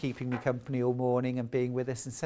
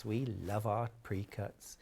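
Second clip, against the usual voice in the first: put-on Norfolk accent; 6 semitones lower, 8 dB quieter than usual. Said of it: "There, I've done my Norfolk accent".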